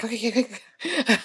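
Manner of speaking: hushed, shaky self-calming okays; nervous quiet okays. Retold or imitated. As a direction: mutter it under your breath, scared. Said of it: "I started shaking in my boots".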